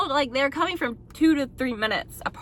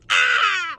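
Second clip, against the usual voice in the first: sharp higher, louder yell of pain; a scream, lighter than the real guttural one; 10 semitones higher, 5 dB louder than usual. Retold or imitated. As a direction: let out a sudden scream as the water breaks. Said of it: "literally screamed like that".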